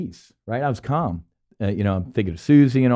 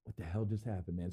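much softer, dazed question; stunned mutter; 4 semitones lower, 17 dB quieter than usual. Retold or imitated. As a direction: mumble it, still in shock. Stunned after leveling off.

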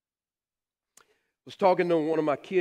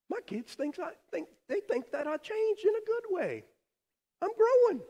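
higher, rising to a proud finish; pleased; 13 semitones higher than usual. Retold or imitated. Dad to daughter, higher and proud. Pastor voices himself, proud.

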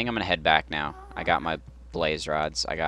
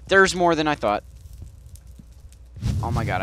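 high loud startled outburst; alarmed surprise; 9 semitones higher, 6 dB louder than usual. Real, not acted. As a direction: blurt it out in alarm. Sees more blazes.

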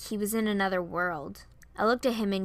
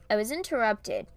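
ordinary delivery

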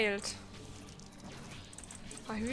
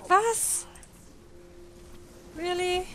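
surprised; 8 semitones higher, much slower than usual. Real, not acted. Sudden shock during the game.

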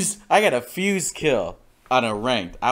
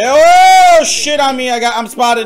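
loud, high yelp of alarm; alarmed shout; 10 semitones higher, 10 dB louder, slower than usual. Real, not acted. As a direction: cry out as you get caught. Suddenly spotted in the game.

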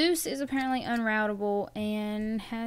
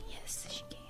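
whispering; 15 dB quieter than usual. Real, not acted. Tense moment against the timer.

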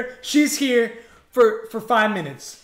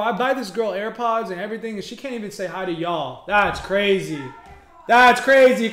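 ordinary talk, nothing marked

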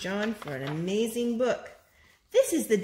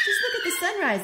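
excited; 10 semitones higher, 6 dB louder than usual. Acted. Excited reading with laugh.

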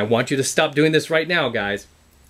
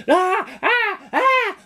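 high whiny "ah" wails; mocking an emotional guy acting out; mock tantrum cries; much slower than usual. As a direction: imitate a man throwing a fit. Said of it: "Whenever I see a guy like".

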